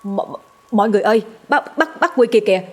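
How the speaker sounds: fast, stammering alarm; frantic call. A narrator's reading. Villager spots Quy lying there, frantic stammering call. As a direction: blurt it out in a panic. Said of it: "người đó kêu lên".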